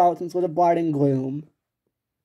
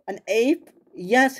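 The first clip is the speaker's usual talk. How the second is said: ordinary talk, nothing marked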